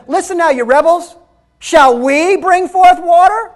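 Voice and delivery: Moses scolding the rebels, loud and high; angry Moses. Acted. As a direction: snap at the rebels with rising anger. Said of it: "He started getting angry".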